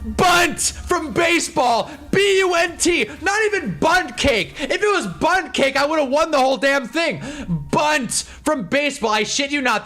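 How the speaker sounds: ranting at the injustice; outraged disbelief. Retold or imitated. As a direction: punch each word in indignation. Outraged reveal of the easy word.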